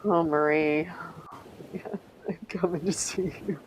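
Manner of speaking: normal talking voice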